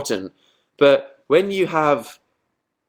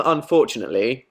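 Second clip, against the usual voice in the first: ordinary talk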